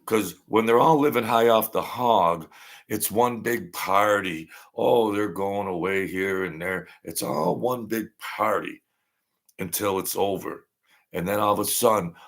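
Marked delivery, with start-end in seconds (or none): none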